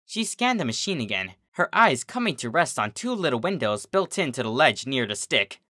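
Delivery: ordinary delivery, nothing marked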